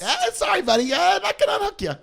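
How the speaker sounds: very high, fast mock cop voice. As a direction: squeak it out like a smug cop. Cop voiced in retold scene.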